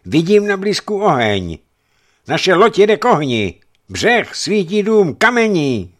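high, loud lookout's shout; loud alarmed cry. A narrator's reading. Young watchman warns the crew of fire ashore, crying out loudly. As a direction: shout the warning at a high pitch. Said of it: "hlasitě vykřikl".

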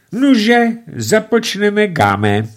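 high, loud, slow proclamation; father announcing. A narrator's reading. Father opens the tennis match, pompous announcement. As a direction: announce the game grandly.

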